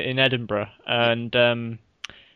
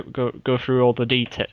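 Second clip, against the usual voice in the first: ordinary delivery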